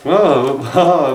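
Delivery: mock-sarcastic laughing ah, ah; a sarcastic little laugh-ah. Retelling the girls' reaction, sarcastic laughing ah. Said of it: "I sound kind of sarcastic saying that".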